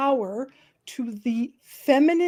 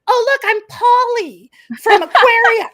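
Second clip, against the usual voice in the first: much higher, louder cartoon character voice; 12 semitones higher, 9 dB louder than usual. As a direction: pop into a bright cartoon character. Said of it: "a voice will slip out".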